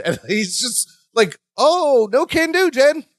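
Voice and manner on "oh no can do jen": much higher, forced casual question; panicky Larry. Retold or imitated. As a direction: fake breezy stall, voice pitched way up. Panicked Larry blocks Jennifer.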